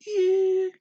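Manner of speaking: ear held way up high and steady; high level tone. Shown as a demonstration. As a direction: sing ear on one high, flat pitch. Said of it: "I'm gonna say it high".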